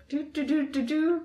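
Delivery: high droning do do do; robot hum noise. Pretend. Robot rolling sound effect.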